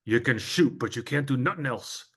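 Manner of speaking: a hint of a gruff, gravelly coach voice; gravelly Red Auerbach. Famous coach sizing up a freshman, lightly mimicked gravelly voice. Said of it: "in that gravelly voice of his".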